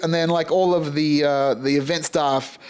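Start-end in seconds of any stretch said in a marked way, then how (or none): none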